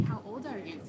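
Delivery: usual speaking voice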